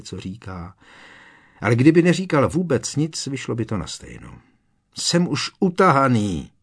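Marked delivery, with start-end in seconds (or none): 4.9-6.5 raised, higher and louder, worn-out protest; fed-up cry, pitched up; exasperated outburst. A narrator's reading. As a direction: burst out that you've had enough. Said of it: "rozkřikl se nakonec".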